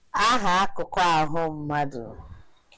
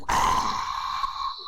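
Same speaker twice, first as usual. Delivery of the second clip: ordinary talk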